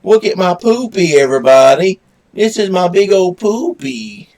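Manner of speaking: high, loud pet-owner cooing. Real, not acted. Showing off his dog, baby talk.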